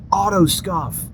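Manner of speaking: high, far-off bark out of a window; mock drill sergeant. Instructor yelling from his window, mock bark. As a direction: call it out lazily from the office. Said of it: "and he'd go".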